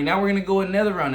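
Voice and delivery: rally him hard for the next round; pumped-up call. Retold or imitated. Urging his tired sparring partner back in, loud and fast. Pushing friend into another round.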